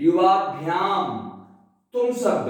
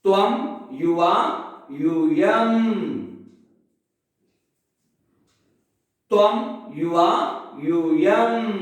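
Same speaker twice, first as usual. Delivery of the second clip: त्वम, युवा, यूयम word by word, gaps between; measured rote chant of त्वम, युवा, यूयम with long pauses; repetition drill; much slower than usual. Shown as a demonstration. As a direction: recite त्वम, युवा, यूयम for students to echo, lingering on यूयम. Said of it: "हम बीच में gap इसीलिए देते हैं".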